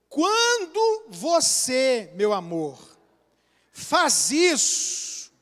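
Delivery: very slow, deliberate, each word placed; calm I-statement. Shown as a demonstration. Husband modelling how to tell his wife he feels disrespected, very slow. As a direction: spell out the feeling slowly and gently. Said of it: "Aí eu vou falar o acontecimento".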